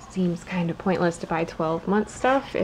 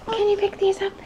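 high singsong; 10 semitones higher, faster than usual. Real, not acted.

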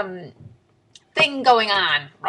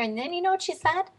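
usual speaking voice

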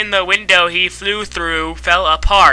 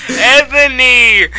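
dramatic call; 5 semitones higher, 4 dB louder, slower than usual. Acted. Calling out name dramatically.

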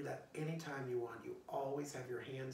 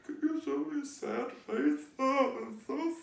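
mock sad; 9 semitones higher, 11 dB louder than usual. Shown as a demonstration. Teacher showing kids a sad face, a touch of mock sadness. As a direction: pout and wail pretend sadness. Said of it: "Can you show me a sad face?".

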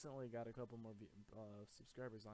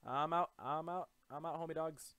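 loud retreat; 6 semitones higher, 13 dB louder than usual. Real, not acted. Fleeing the creeper.

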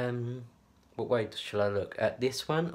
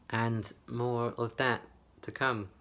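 ordinary talk

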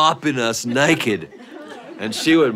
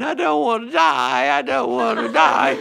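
mock crying child; 3 semitones higher than usual. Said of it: "brother started crying".

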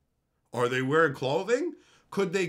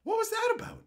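confused squeak. Retold or imitated. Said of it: "he was just like".

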